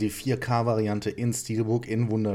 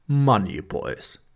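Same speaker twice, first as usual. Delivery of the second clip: slow, loud dramatic trailer announcer; 6 dB louder, much slower than usual. Pretend. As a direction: boom the title like a trailer. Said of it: "Muss ja die Trailerstimme machen".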